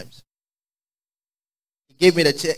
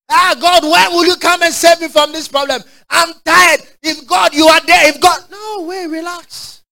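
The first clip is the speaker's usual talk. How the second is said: high, whining mock lament; complaining believer; 11 semitones higher, 4 dB louder than usual. Preacher mimicking a tired believer wailing at God. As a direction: cry out to God like a worn-out complainer. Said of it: "The person in the spirit don't talk like that".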